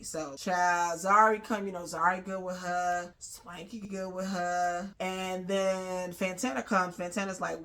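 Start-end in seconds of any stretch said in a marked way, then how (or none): none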